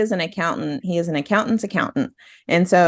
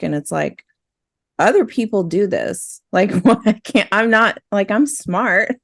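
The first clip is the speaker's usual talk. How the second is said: normal talking voice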